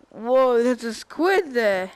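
loud amazed whoa; amazed shout. Real, not acted. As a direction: marvel at the squid. Spots a squid.

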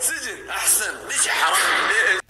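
loud teasing shout, slow at first; playful shouting match over who pays; mock yell. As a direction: bellow the threat half-joking. Mock threat over the bill.

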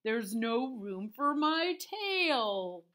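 loud, very slow complaining cow; whiny demanding cow; bossy cow. A narrator's reading.

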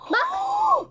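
sudden high yell; startled shout. Real, not acted. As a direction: shout it in fright. Scared at game.

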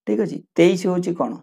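ordinary talk, nothing marked

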